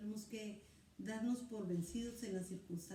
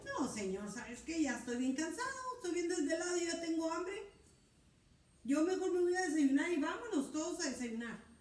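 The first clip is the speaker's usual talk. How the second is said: tired grumble; 7 semitones higher, 3 dB louder than usual. Acted. Pedro voiced as tired and hungry.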